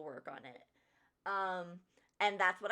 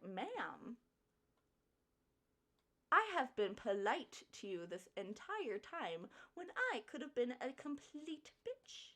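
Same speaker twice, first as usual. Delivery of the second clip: restrained incredulous retort, trailing softer; disbelief; 5 semitones higher, 4 dB quieter than usual. Retold or imitated. Employee answering a lying customer, in disbelief. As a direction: hold back your outrage, in disbelief. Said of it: "in disbelief".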